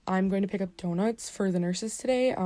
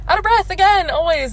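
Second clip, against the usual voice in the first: out of breath again, higher and louder; exasperated outburst; 11 semitones higher, 10 dB louder than usual. Real, not acted.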